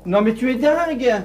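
exclamation outrée, haute et forte; s'écrier, abasourdi par la proposition; scandalisé. Acted. (indigné, scandalisé)

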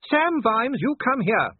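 high, loud, commanding wife; booming call. A narrator's reading. Sybil calls her husband along the corridor, booming. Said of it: "Sybil's voice boomed along the corridor".

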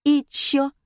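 put-on stand-offish girl refusing to engage; uncooperative. A narrator's reading. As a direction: shut the conversation down cheekily. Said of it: "не пошла на контакт девочка".

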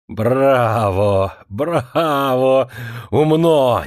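sarcastic, drawn-out bravos; angry shouting. A narrator's reading. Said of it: "закричал он".

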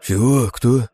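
jolted awake and outraged; sleepy indignant. A narrator's reading. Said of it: "возмутился сонно драгун". (сонное возмущение)

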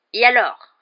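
loud, high, brusque challenge; curt retort. A narrator's reading. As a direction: cut him off impatiently. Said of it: "répliqua sèchement l'oncle Vernon".

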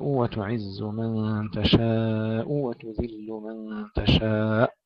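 "تشاء" very slow with a small push on the hamza; slow tajweed demo. Shown as a demonstration. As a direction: recite "وتعز من تشاء" slowly, pushing the hamza. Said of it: "ندفع الهمزة دفعة صغيرة".

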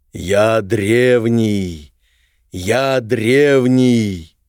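slow booming ancient voice from the depths; echoing ethereal energy being. Acted. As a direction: drag each word out like a stone giant waking. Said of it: "гулкий голос". (гулкий древний голос)

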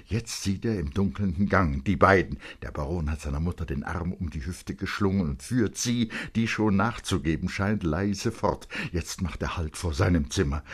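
normal talking voice

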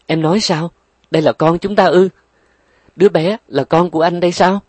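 stunned, can't believe it; astonished. Acted. Father learning the baby is his, loud astonished disbelief.

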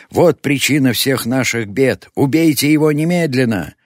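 high, loud, furious command; raging shout. A narrator's reading. New leader rouses the crowd to kill, enraged shouting. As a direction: scream for blood, rising on the repeat. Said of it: "бился в злобе новый вожак".

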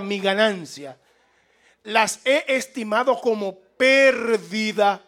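usual speaking voice

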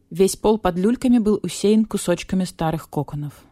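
ordinary delivery, nothing marked

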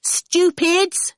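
put-on high, taunting child's call. A narrator's reading. Awful, lurking at a safe distance, calling out. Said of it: "Awful called out".

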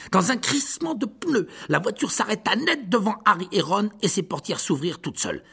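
brisk, high, urgent storytelling; excited narration. A narrator's reading. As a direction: tell the chase with rising urgency. Narrating car chase excitedly.

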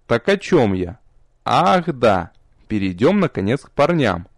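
usual speaking voice

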